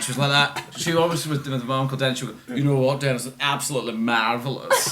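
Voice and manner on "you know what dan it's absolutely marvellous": imitating a prim Edinburgh Morningside lady; a posh Morningside Edinburgh voice, lightly done; Morningside accent. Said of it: "who spoke like that".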